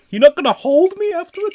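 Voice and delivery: high, plaintive put-on plea; hammed-up little wounded voice; mock needy lover. Playing a needy lover after sex.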